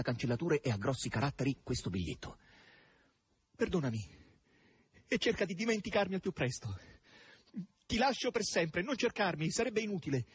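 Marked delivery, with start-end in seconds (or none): 3.6-10.2 high-pitched voice of Margherita's letter; farewell note. A narrator's reading. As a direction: read her parting note in a raised, higher voice.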